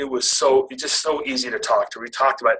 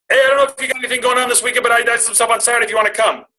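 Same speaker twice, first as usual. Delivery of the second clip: much higher, louder and fast, nervous rush; much higher, rushed, nervous blurt; blurted invite; 10 semitones higher, 3 dB louder, much faster than usual. Retold or imitated. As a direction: rattle the invite out in one breath. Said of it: "blurted it out".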